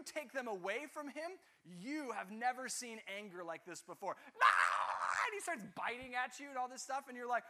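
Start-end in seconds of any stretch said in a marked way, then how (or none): 4.4-4.7 short explosive shout, mimicking a furious baby; toddler's angry scream. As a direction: blast out one wild baby yell. Son's rage when devices taken.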